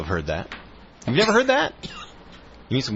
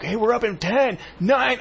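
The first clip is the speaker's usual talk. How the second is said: floor director yelling; 13 semitones higher than usual. Retold or imitated. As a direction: shout the countdown at the bickering anchors. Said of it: "I'm just yelling at 'em like".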